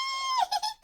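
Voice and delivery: a bright, delighted giggle, pitched high; delighted high laugh. Acted. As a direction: laugh with joy on arrival. Queen arriving delighted.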